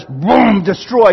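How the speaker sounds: loud exploding boom, then a hard word; forceful destruction. Shown as a demonstration. Preacher mimicking harsh prayer language, forceful. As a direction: hit it like a blast. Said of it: "No longer do we have this".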